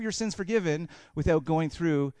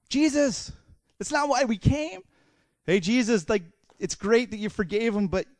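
higher, urgent objection to Jesus; exasperated complaint, pitched up; acted protest; 5 semitones higher, 3 dB louder than usual. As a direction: push back in disbelief. Acted protest of disappointed friends.

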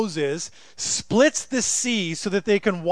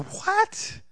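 sudden high disbelieving what; a startled, much higher yelp of disbelief; incredulous what; 13 semitones higher than usual. Acted. Preacher reacting to the grumbling Israelites, high incredulous.

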